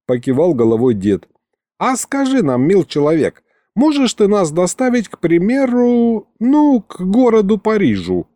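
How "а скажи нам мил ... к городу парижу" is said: put-on old grandpa coaxing, pitched high; дед. A narrator's reading. Дед расспрашивает голос из коробки. (дед вкрадчиво, высоким голосом)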